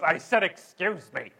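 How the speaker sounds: loud, emphatic imitation of indignation; mock snippy retort. Imitating flight attendant answering back, loud snippy tone. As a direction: insist you already said it, with attitude. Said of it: "in that exact tone".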